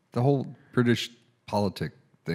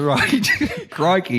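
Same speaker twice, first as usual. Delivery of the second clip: laughing through a hammed-up Australian accent, high and loud; goofy Australian exclamation, much higher; mock Australian accent; 9 semitones higher, 4 dB louder, much slower than usual. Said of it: "[name], that's Australian".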